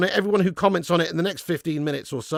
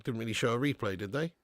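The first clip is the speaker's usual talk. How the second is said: ordinary talk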